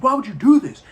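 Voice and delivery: put-on a boy screaming at the heron in rage; retold furious outburst, loud and high; angry shout. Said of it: "he's mad".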